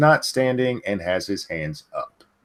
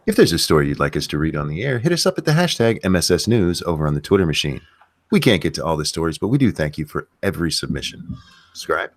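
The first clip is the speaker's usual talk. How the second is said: fast, punchy radio announcer read; newscaster voice; 5 semitones lower, faster than usual. Acted. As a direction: rattle it off like a TV anchor. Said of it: "let me get my newscaster voice on".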